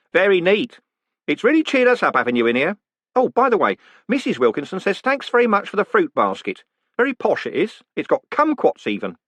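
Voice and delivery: high, bright-voiced jolly warder; cheerful warder. Warder reporting the prisoner's words to the condemned man, cheerfully. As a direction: play the polite prison warder chatting brightly.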